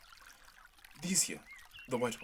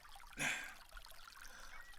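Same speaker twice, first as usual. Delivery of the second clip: quiet airy sigh before confiding; soft sigh; 10 dB quieter than usual. Acted. Prince about to share his secrets, soft sigh. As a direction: breathe out softly, gathering yourself.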